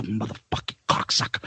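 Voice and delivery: low, soft swearing under the breath; muttered cursing. Retold or imitated. Teacher wiping down the blackboard, muttering curses. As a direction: mumble it to yourself, barely voiced. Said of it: "he would mumble under his breath".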